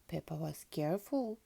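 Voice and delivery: plain reading voice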